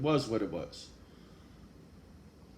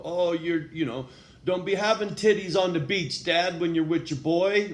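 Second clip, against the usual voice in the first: louder, higher tough-love callout; Wes Watson tough talk; 5 semitones higher, 6 dB louder than usual. Retold or imitated. Telling dads off about their weight, louder tough-love callout. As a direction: hammer it like a tough-love coach, lightly imitated. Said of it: "That's some of that Wes Watson shit".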